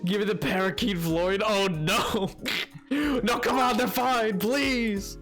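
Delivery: usual speaking voice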